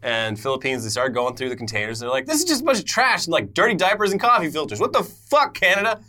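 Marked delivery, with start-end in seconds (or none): 2.2-6.0 pitched-up shocked complaint; outraged disbelief. Retold or imitated. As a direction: sound disgusted at the trash. Philippines voiced, outraged.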